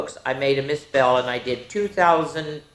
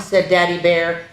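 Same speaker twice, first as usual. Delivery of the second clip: usual speaking voice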